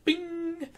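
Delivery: very high "bing!" chime; ping it out like a notification; bing sound effect. Acted. Pointing to an on-screen link.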